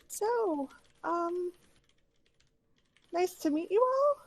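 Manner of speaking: put-on high child voice, "all" rising like a question. Little girl greeting the strangers, questioning rise on "all". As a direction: lift "all" high at the end, as a hesitant little girl would. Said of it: "kind of in a questioning voice".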